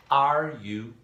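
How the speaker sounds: slow, clear repeat of the question; slow model question. Shown as a demonstration. Teacher repeating the question slowly for the class. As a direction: say it slowly so they can repeat.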